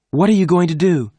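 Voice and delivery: high, loud frightened cry; alarmed cry. A narrator's reading. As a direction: cry it out, scared for her friend. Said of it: "Jane cried".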